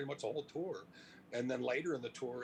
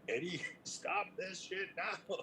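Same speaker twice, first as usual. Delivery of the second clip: high, slow pleading call to Eddie; pleading band; 8 semitones higher, slower than usual. Retold or imitated. Band begging Eddie to quit the stunt, high and slow. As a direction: beg him to stop, half laughing.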